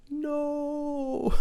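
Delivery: a despairing no kept inside; silent inner no. Retold or imitated. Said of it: "And on the inside, I'm like".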